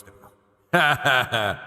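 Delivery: slow, processed god voice effect; Yahweh voice. Shown as a demonstration. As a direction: voice filter like a divine being. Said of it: "Yahweh voice".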